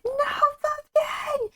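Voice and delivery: high, slow hammed-up crying wail; theatrical sobbing no; mock wail. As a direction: wail in mock grief for the cat. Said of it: "I was like".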